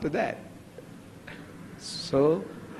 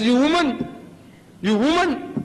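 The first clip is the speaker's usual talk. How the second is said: high, loud accusing jab at the woman; mock accusing; 8 semitones higher, 4 dB louder than usual. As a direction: point the finger and blame her in a mock scold. Adam blames the woman.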